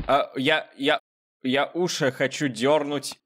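usual speaking voice

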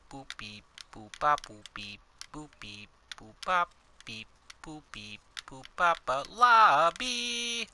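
robot beeping. Pretend. Robot noises during load screen.

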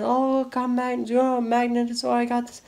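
imitating excited children, high-pitched; excited kids. Vlogger retelling kids showing off their magnet, mock-excited. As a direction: mimic kids eagerly showing off.

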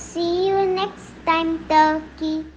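high, loud fox voice, mildly scared; lightly acted scared fox; frightened fox. A narrator's reading. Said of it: "said frightened Fox".

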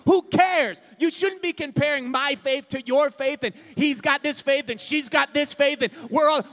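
loud, rising preacher's cry; preaching at full force. Real, not acted. Preacher rebuking the church over comparing faith, shouting. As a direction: preach it hard, building to a shout.

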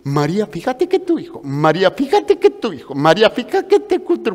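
high, eager gossip voice; gossiping neighbors. Retold or imitated. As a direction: rush to tell María the news. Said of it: "Pues imagínense".

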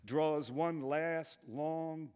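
usual speaking voice

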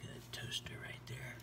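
hushed whisper. Real, not acted. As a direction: whisper it like a secret. Points at toaster quietly.

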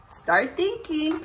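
ordinary talk

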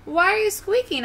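squeaky-high storybook mouse. Acted. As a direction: ask it in a little mouse's high voice. Mouse character questions little lion.

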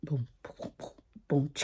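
usual speaking voice

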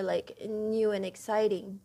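ordinary talk

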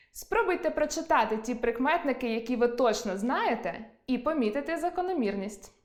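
ordinary delivery

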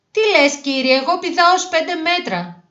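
high, loud cocky protest; little show-off insisting to the teacher; insistent boasting. A narrator's reading. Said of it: "επέμενε". (επίμονο καμάρι με ψηλή φωνή)

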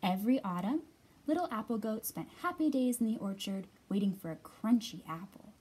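ordinary delivery, nothing marked

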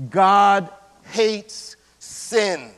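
God hates sin, each word drawn out; very slow weighty stress. Real, not acted. Preacher warning congregation, slow heavy stress on God. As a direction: hammer every word slowly.

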